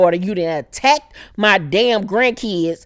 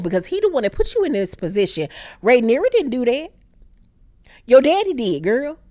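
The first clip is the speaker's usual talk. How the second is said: usual speaking voice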